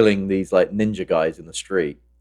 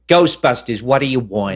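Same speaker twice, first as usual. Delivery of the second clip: louder, higher, put-on phone answer; brusque phone receptionist; 4 semitones higher, 3 dB louder than usual. Picking up the Ghostbusters phone, answering brusquely. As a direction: pick up and demand what they want. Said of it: "should I pick it up and go".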